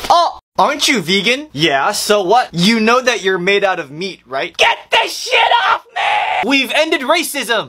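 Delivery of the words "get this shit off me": high, disgusted shriek; horrified shout. Acted.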